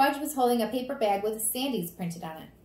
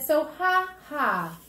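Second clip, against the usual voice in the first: slow, high, louder gloating; childish taunt; 7 semitones higher, 6 dB louder, much slower than usual. Acted. Daniel to the scolding adults, taunting. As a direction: rub it in, stretching each word. Defiant child answers back.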